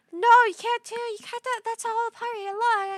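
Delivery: high, loud mocking voice of the crowd; mock objectors. Imagined viewers objecting to her nose job, high mocking voice. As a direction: parody the people who'll protest. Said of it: "I know everyone's gonna say".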